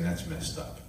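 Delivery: whispered, voiceless retelling; soft whispered disbelief; muttered under the breath. Retold or imitated. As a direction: breathe it out in a hushed, toneless aside. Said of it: "he walked away saying".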